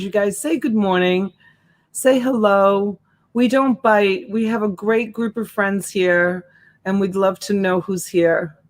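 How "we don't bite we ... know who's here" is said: ordinary talk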